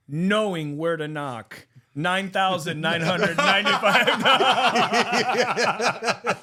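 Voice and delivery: loud, very slow, drawn-out itemizing; emphatic punchline. Acted. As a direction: build from a measured read to a big, stretched-out price. Punchline of the joke, itemized bill.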